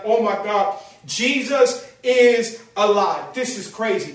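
slow, drawn-out amazed announcement; amazed proclamation. Acted. Preacher as Mary telling the disciples, amazed. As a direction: stretch each word in joyful disbelief.

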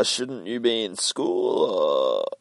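slow teasing question. Acted. Finch teasing young girl.

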